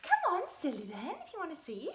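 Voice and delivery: high, cooing sing-song for a pet; dog voice. Real, not acted. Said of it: "That's my dog voice".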